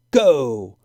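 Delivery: one loud sharp start shout. A narrator's reading. Judge Dan starting the sled race, loud shout. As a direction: bark the start.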